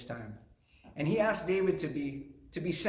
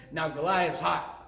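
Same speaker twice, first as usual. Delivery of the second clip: usual speaking voice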